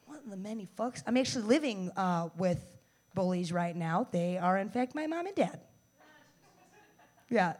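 ordinary delivery

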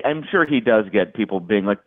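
usual speaking voice

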